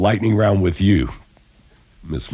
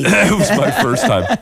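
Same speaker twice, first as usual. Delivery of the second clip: much higher, giggling through the words; laughing; 5 dB louder than usual. Real, not acted. Host laughs while telling her guest about Australian coffee. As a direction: laugh it out, a bit embarrassed.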